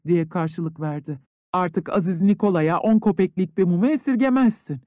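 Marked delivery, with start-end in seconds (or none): none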